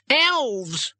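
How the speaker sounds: high, drawn-out cry; cry of alarm. A narrator's reading. Gnome fleeing to a mouse hole, alarmed cry. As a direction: cry it out, pitched right up. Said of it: "With a cry of".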